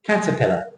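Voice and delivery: caterpillar with CAT stressed and the tail falling softly; correct English stress. Shown as a demonstration. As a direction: hit CAT, reduce the rest of caterpillar. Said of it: "Different shape altogether".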